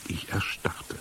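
barely voiced, frozen with dread; hushed and very soft. A narrator's reading. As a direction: drop to a whisper. Narrator freezes in fear.

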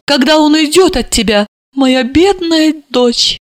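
high-pitched, overwrought lament; mock, then wail over her fate; scornful tirade. A narrator's reading. Mother warning daughter about her husband, overwrought.

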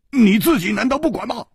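high, quick character voice; urgent, high-pitched challenge; challenging question. A narrator's reading. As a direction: press for an answer, pitched high.

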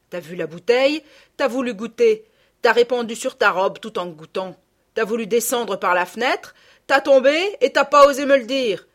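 loud tirade, building in force; country mother's mounting accusation; accusing scolding. A narrator's reading. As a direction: list the misdeeds like an angry mother. Mother accuses her daughter.

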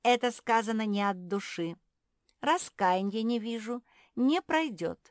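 loud, high, imperious frog; haughty fault-finding; cutting judgement. A narrator's reading. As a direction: reject the apology like an offended grandee. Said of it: "отрезала лягушка".

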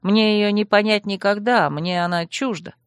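ordinary delivery

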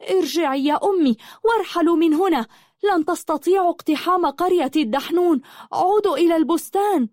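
high-pitched calling out; urgent warning. A narrator's reading. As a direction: call out urgently to send them back. Daughter urgently warns mother to retreat. (نداء تحذير عاجل بصوت عالٍ)

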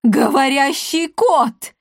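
slow, high cry of shock; frightened. A narrator's reading. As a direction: gasp it out in astonished alarm. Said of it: "перепугалась Клеопа".

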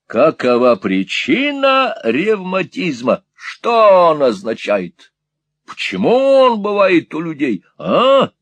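slow, high, loud questions; loud interrogation. A narrator's reading. Squeers quizzes deaf Peg on rheumatism, loud and insistent. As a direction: press each question on her loudly.

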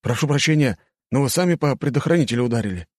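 quick apologetic soldier; guard voice. A narrator's reading. Guard excuses, higher, fast.

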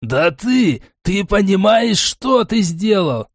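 high, agitated wailing reproach; distraught lament. A narrator's reading. Gnome berates the bettor, wailing in distress. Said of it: "продолжал причитать гном".

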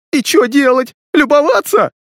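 very high trembling hysterical yell; hysterical, shaky voice. A narrator's reading. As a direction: voice shaking with panic. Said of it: "нотки истерики, голос предательски дрожал". (истерика, голос дрожит)

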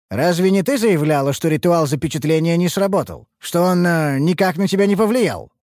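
high, pointed questioning; accusing challenge. Acted. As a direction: corner someone with their own words. Challenges elf with her own claim.

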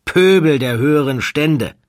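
disgusted aristocrat sneering at his own class; contemptuous. Acted. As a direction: spit out the insult with disdain. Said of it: "Er findet nur verächtliche Worte für seinesgleichen".

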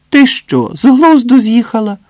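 high, loud, incredulous teasing; amused disbelief. A narrator's reading. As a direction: exclaim as if your friend has gone mad. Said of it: "засміялася Свєтка".

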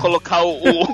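ordinary talk, nothing marked

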